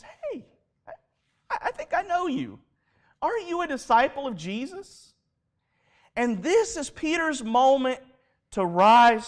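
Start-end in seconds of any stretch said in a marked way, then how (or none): 0.0-5.1 high-pitched bystander piping up; suspicious bystander. Retold or imitated. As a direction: lightly act out someone recognizing a stranger. Bystander spotting Peter.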